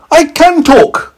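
very high, slow squeaky voice; talking meteorite voice. A narrator's reading. Meteorite announces itself to scientist, high squeak. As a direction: stretch it out tiny and squeaky. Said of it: "The meteorite said".

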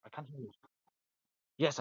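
normal talking voice

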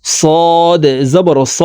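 صاد said thick and drawn out; heavy letter. Shown as a demonstration. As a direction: give স a heavy, full s. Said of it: "সদ মোটা করে উচ্চারণ করতে হবে".

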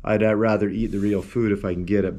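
ordinary talk, nothing marked

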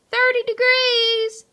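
high, ringing announcement; loud, high, proud call-out. A narrator's reading. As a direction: announce it to the whole team. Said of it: "she announced".

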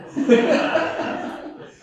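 airy high-pitched laugh; breathless amused laugh; high breathy laugh. Real, not acted. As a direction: let out a breathy giggle. Laughs at the memory.

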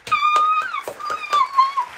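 very high squealing cheer; mock crowd cheer. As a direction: scream like a thrilled audience.

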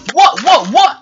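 high, loud startled repeats; alarmed surprise. Acted. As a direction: blurt it out in mock alarm. Flynn reacts to tornado news.

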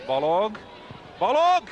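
name called rising to a shout; excited shout. Real, not acted. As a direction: shout the chance as it happens. Chance call, live commentary. (izgatott kiáltás)

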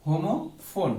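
ordinary talk, nothing marked

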